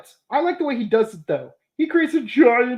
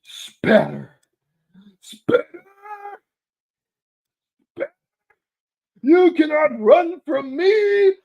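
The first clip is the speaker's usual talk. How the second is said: villain quote; 10 semitones higher, 4 dB louder than usual. Retold or imitated. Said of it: "He's like".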